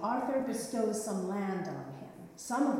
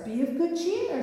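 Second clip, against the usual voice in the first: put-on much higher, louder young knight cheering his uncle; youthful knight; 9 semitones higher, 7 dB louder than usual. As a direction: lift your uncle's spirits. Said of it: "said the youthful knight".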